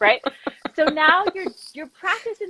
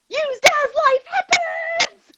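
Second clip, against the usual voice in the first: much higher, slow, thrilled shout; excited; 4 dB louder, slower than usual. Retold or imitated. Son finishing his chore card in German, excited. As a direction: squeal it out like a proud kid who just nailed it. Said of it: "he was so excited".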